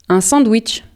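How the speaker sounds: "sandwich" said the French way, with nasal san; Frenchify "sandwich" as sand-ouitche; prononciation française. Shown as a demonstration. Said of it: "J'ai un accent français". (« sandwich » prononcé à la française)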